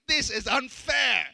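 indignant outburst. Retold or imitated. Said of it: "I'm like".

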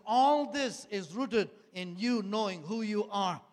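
slow emphatic prophecy. Retold or imitated. Repeats prophet's solemn word.

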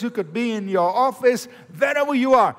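ordinary talk

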